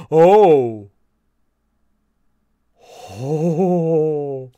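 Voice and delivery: loud, delighted realization; excited gasp. Real, not acted. Streamer learning Part one is out, loud delight. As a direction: gasp then rise with glee.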